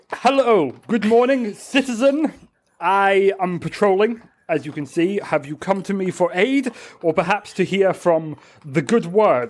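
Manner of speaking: high-pitched, pompous character greeting; formal zealot. Acted. Patrolling guardian offering a citizen aid, formal zealot. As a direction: greet them formally as a self-appointed guardian.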